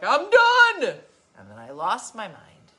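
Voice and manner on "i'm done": loud high-pitched outburst; yelling I'm done. Retold or imitated. To the sick dog, a loud fed-up yell. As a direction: blow up at the dog. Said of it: "I was like".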